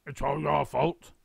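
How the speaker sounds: put-on slow, accusing old man snarl; yelled. A narrator's reading. Mister Twit blaming his wife while stuck. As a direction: hurl the blame at her. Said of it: "yelled Mister Twit".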